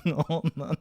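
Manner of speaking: high words breaking up with laughter; laughing. Real, not acted. Comedian losing it at his own pun, real laughing. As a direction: crack up while repeating the punchline.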